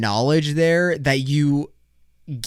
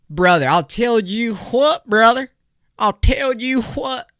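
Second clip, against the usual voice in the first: mock Texan brother; 8 semitones higher, slower than usual. Texan buddy catchphrase.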